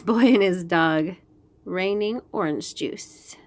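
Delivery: ordinary delivery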